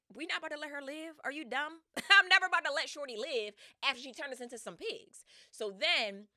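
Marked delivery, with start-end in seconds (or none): 0.1-5.2 higher, louder indignant rant; angry men. Retold or imitated. Freed soldiers vowing revenge on Circe, loud and angry. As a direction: rage about getting revenge. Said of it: "they like".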